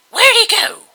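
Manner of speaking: high, loud frantic shout; shrieking fangirl. A narrator's reading. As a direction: yell like a crazed teen fan. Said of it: "one of them shouted".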